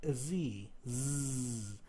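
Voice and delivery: voiced "z" held and buzzing; voiced "z" with a hum; buzzed z sound. Shown as a demonstration. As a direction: buzz the "z" like a bee. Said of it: "The second sound you hear is".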